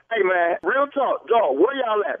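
normal talking voice

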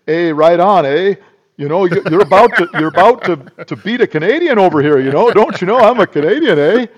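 loud, high, hammed-up Canadian accent with eh; cartoon Canadian accent, loud and high; mock Canadian accent. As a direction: play a jokey Canadian protesting a beating. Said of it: "like, I would have said".

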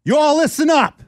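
high-pitched imitated officer's yell. Enemy officer to captured riders, imitated yelling. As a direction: bellow it like a mock drill order. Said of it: "he's yelling at us".

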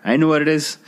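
high, gleeful shout; a little girl bursting to tell; high gleeful shout of a small girl; excited shout. Acted. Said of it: "shouted the seven-year-old sister".

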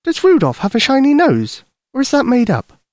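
put-on very high child's voice; curious little girl. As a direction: ask like an inquisitive small girl, pitched high. Lucy quizzes Santa.

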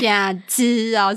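slow, teasing echo; drawn out. Real, not acted. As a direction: stretch the words out slowly.

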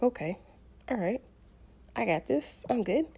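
hushed pep talk to oneself; quiet, private reassurance, acted out; self-reassuring. Self-reassurance during contractions in Walmart.

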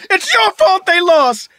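high, loud, slow accusing yell; hammed-up kid's angry yell; upset kid's outburst. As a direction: imitate a wailing child laying blame. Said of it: "he goes".

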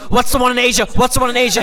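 high, loud demanding yell. Retold or imitated. Yelling at his dad about the Yeti, loud and high. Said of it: "I started yelling".

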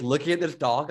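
ordinary delivery

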